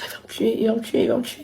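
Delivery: whispered, barely voiced, in fear; terrified whisper. Thinking her father will kill her, whispered in fear. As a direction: breathe it out scared stiff.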